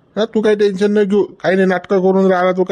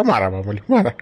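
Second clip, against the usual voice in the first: low and soft, hurt and sulking; sulky self-pity; 8 semitones lower, 3 dB quieter than usual. Acted. Nephew sulks at his uncle after the scolding, low and soft. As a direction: sink into wounded self-pity.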